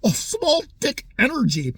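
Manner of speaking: mocking Greta. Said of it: "that lame comeback".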